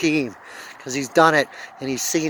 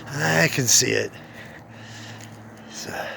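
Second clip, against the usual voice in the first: choked up; 4 semitones lower, 6 dB quieter than usual. Real, not acted. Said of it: "I got a little emotional".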